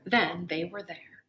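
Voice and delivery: ordinary delivery, nothing marked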